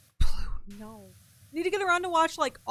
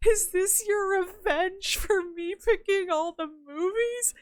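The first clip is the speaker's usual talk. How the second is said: higher, louder, drawn-out tearful accusation; mock tearful; 8 semitones higher, 5 dB louder, slower than usual. Partner accusing them after the sad movie, mock tearful. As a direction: imitate a teary, wounded partner. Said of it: "tearing up and crying".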